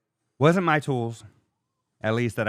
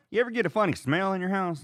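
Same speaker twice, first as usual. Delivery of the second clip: higher, quick imitation of the plumber; plumber's voice; 9 semitones higher, faster than usual. Plumber asking the homeowner about smells, quick and higher. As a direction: fire off the question like the nosy plumber. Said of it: "the guy's like".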